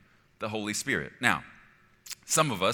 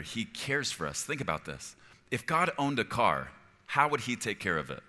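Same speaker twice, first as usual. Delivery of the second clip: ordinary talk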